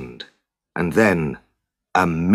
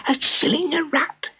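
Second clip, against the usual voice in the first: far higher, squeaky shocked gossip; gossiping crowd; 4 dB quieter than usual. Acted. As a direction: squeak it in disbelief to your neighbour. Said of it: "swept through the crowd in whispers".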